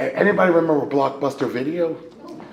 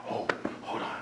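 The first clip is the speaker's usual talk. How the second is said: whisper; 13 dB quieter than usual. Retold or imitated. Whispering to self in dark.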